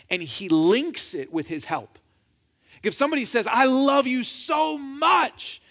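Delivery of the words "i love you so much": drawn-out, high, gushy declaration of love; gushing affection. Acted. Pretend friend declaring love before a favor, gushing. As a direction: gush it out, stretching every word.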